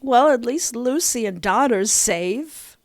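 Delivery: ordinary delivery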